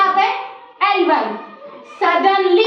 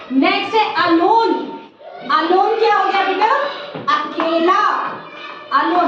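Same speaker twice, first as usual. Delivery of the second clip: usual speaking voice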